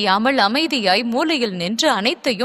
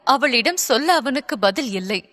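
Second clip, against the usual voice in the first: plain reading voice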